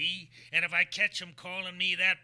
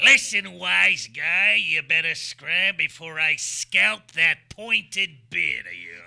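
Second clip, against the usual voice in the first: menacing threat; 7 dB louder than usual. Acted. Luigi threatens the visitor, loud and menacing. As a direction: lean in and threaten him with real menace. Gangster threatens to scalp him.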